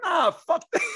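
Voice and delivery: high, loud retold outrage; mock outrage. Panelists shut down the rude caller with loud mock outrage. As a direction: play the panel's offended pushback. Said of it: "they're like".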